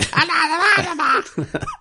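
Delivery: demon shriek. Pretend. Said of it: "I just ruptured a voice, voice, uh, vocal cord there".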